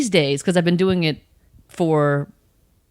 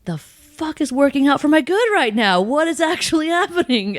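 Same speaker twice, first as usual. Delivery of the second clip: much higher, incredulous mock rant; hammed-up baffled complaint, pitched up; mock exasperation; 9 semitones higher than usual. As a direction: vent in mock outrage at the universe. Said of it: "be like".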